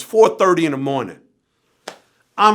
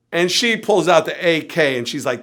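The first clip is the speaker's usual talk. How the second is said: usual speaking voice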